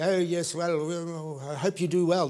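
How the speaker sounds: mock polite spectators. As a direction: play a feeble, half-hearted supporter. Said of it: "They're not sitting up there going".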